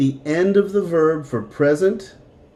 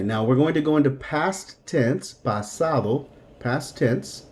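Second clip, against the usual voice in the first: ordinary talk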